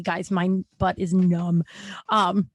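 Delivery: usual speaking voice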